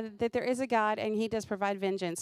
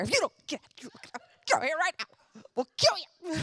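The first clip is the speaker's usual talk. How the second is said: retold mom threat; 7 semitones higher, faster than usual. Mother threatening daughter, retold.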